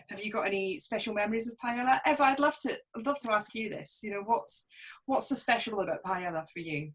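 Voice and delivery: ordinary talk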